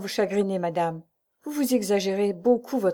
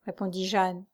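usual speaking voice

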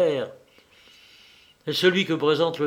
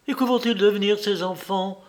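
high-pitched dramatic exclamation; crying out; 7 semitones higher than usual. A narrator's reading. Wife demanding what becomes of the children, crying out. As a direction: cry out the question in distress. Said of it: "s'écria la femme".

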